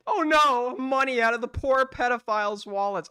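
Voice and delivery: loud, high mock horror; sarcastic overblown pity for the poor pedophiles; mock outrage. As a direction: fake gasp of sarcastic sympathy.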